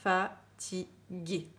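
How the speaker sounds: "Fatigué" très étiré, lentement; étire "Fatigué" au maximum; dictée très lente. Shown as a demonstration. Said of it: "je mets l'accent sur les points sur I". (dictée très lente)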